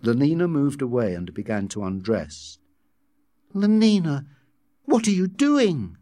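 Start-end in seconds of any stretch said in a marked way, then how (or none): 3.5-6.0 high, alarmed cry; appalled, moderately raised shout; horrified shout. A narrator's reading. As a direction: cry out in horror, voice raised in pitch. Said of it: "he shouted, horrified".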